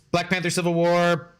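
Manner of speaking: ordinary talk, nothing marked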